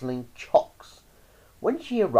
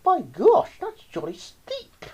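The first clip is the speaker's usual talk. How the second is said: indignant cry; 3 dB louder, slower than usual. A narrator's reading. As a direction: exclaim high at the outrageous fee. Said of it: "She cried".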